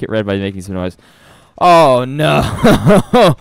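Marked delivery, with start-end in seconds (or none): 1.6-3.3 louder, higher groan; startled. Real, not acted. Streamer reacting to the mic test, startled.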